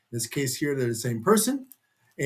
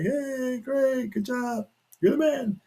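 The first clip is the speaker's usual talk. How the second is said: higher, cheery praise from the crowd; cheering fans; 10 semitones higher, slower than usual. Acted. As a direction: cheer him on, bright and high. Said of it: "and say".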